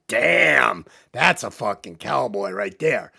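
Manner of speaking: low, appreciative mock ogling; lustful admiration. Sissy eyeing Wes on first sight, lustful admiration. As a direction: savour him like a juicy steak. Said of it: "looks at him like a fucking eight-ounce New York cut steak".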